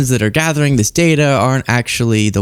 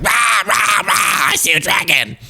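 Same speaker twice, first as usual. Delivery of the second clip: mock shouting, a hint of Trump; hammed-up raving at an imaginary dragon; Trump tripping; 5 semitones higher than usual. Comic mocking Trump as a tripper, mock shouting. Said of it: "Trump's like".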